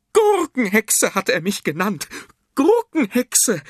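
high, loud scolding woman; shrill nagging. A narrator's reading. Fat woman complaining to a policeman about an insult, shrill nagging. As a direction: scold at the top of your pitch, outraged. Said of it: "keifte die Frau".

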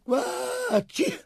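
very high, drawn-in elephant sneeze; huge trumpeting sneeze. A narrator's reading. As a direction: build up and burst into a sneeze. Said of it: "laut und gewaltig niesen. Er trompetete". (gewaltiges, trompetendes Niesen)

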